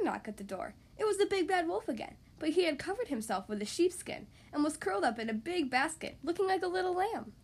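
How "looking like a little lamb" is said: plain reading voice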